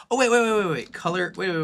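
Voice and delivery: high, loud rapid waits; real sudden excitement at an idea; excited realization. Real, not acted. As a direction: blurt it out as the idea hits. Sudden idea while working.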